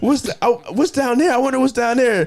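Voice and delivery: high, sing-song false curiosity; fake innocent. Dad luring daughter under the stage, mock sweet high voice. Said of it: "He's like".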